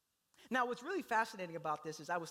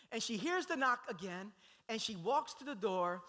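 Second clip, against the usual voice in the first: normal talking voice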